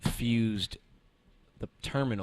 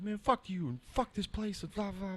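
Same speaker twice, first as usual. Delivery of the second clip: higher, lightly voiced cursing customer; mock customer rant; 12 semitones higher than usual. Departing customer cursing at them, mock rant. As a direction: toss off his insults mockingly.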